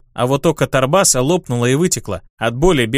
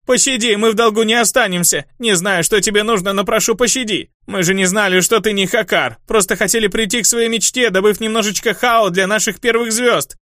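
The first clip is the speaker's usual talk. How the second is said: much higher, rattling frantic plea; desperate begging; 7 semitones higher than usual. A narrator's reading. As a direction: babble for mercy in panic. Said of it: "Бес затрещал".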